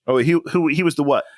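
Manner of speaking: ordinary talk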